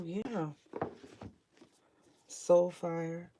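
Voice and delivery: ordinary delivery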